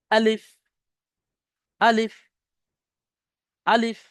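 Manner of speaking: আলিফ said quickly, final ফ breathed out between the lips, no stretch; quick short alif. Shown as a demonstration. Teacher demonstrating Arabic letters to online class, quick clipped alif. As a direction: clip আলিফ short with a puff of air. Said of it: "আলিফ পড়ার সময় এটা কিন্তু তাড়াতাড়ি হচ্ছে".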